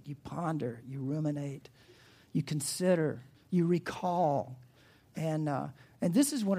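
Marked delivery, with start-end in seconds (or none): none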